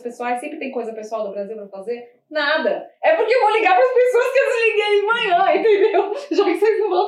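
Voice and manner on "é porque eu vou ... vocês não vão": giggling through a confession; words bubbling with laughter; laughing, higher and louder. Real, not acted. Confessing her lunch-hour phone trick, laughing.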